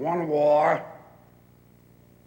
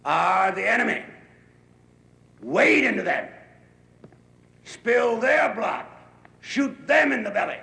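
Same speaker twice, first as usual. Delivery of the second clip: rousing shout; 6 semitones higher than usual. Acted. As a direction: whip the troops into a frenzy. General rousing his troops.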